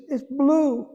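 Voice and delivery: high, mild imitation of a crying wife; retold tearful wife. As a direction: say it lightly, as she said it in tears. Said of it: "and she's crying".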